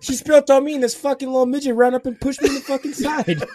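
very high, loud whiny protest; hammed-up, high-pitched indignant rant; mock indignant victim. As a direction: imitate an aggrieved guy pleading his case. Acts the wronged guy complaining.

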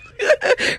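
a high, loud cackle of a laugh; high burst of laughter. Real, not acted. Laughing at her own careless remark, a high loud laugh.

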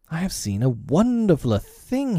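put-on high, wondering child's voice; boyish cry. A narrator's reading. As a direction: exclaim it high, like an amazed boy. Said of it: "he cried".

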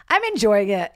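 usual speaking voice

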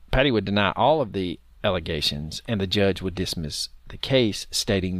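toned-down reading. Real, not acted. Host repeats the denial after being told off, toned down. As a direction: read it without yelling, voice dropped. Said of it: "Don't yell, man".

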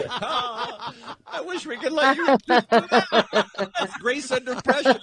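laughing through the words. Real, not acted. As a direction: talk while cracking up. Host laughing through line.

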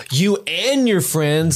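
slow, high, drawn-out dismissal; mock angry ejection. Captain throwing friends off the boat, mock outrage. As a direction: draw it out like an umpire's ejection.

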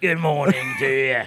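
loud, hammed-up English greeting; mock old English pleasantry; pleasant English accent. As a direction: wish good morning in a broad English accent. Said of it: "but say something pleasant".

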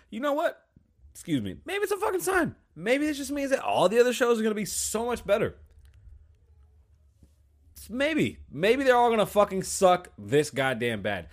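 sarcastic. Acted. Mock-cheerful exaggerated self-pep talk.

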